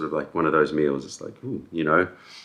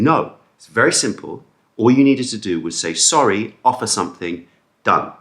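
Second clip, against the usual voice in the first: retold scolding, higher and louder; mock stern rebuke; 4 semitones higher, 6 dB louder than usual. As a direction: imitate dad telling off the manager. Acts out dad rebuking the manager.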